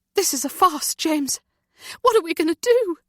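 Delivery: mother breaking down in fear; frantic despair; terrified. A narrator's reading. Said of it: "she's terribly scared".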